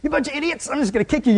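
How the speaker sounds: hammed-up angry outburst; mock anger. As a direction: pretend to blow up at them. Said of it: "I would have been so mad".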